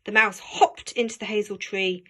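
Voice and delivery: ordinary delivery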